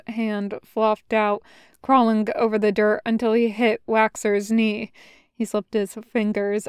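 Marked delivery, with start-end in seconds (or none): none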